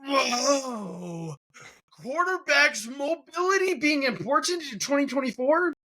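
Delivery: high-pitched sarcastic astonishment; mock amazement. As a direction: feign being stunned by the obvious. Sarcastic mock amazement at obvious point.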